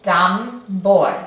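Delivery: "Tambor" split into tam and bor with a gap; syllable by syllable. Shown as a demonstration. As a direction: break "Tambor" into two firm, loud syllables. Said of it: "Vamos a dividirlo". ("Tambor" dividido por sílabas, tam, bor)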